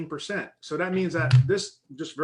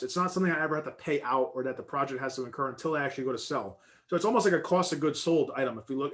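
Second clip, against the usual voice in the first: normal talking voice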